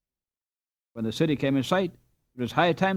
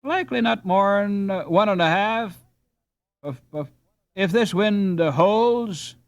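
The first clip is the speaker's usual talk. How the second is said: put-on higher, halting old boatman; skipper voice, pausing between puffs; 6 semitones higher, 4 dB louder than usual. A narrator's reading. Skipper answering between puffs on his pipe. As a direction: speak around a pipe.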